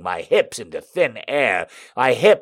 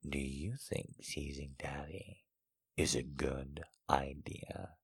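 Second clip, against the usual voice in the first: low, hushed male voice; stern teasing lover; 10 semitones lower, 23 dB quieter than usual. A narrator's reading. Man challenging his lover's teasing, low and hushed. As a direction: drop low and very quiet, a soft warning. Man's stern tease in story.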